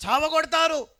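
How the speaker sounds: rising to a high, loud preaching cry; preaching build-up. Acted. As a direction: build the point up to a shout. Preacher warns of persecution. (పెరుగుతున్న ఉత్సాహంతో ప్రసంగం)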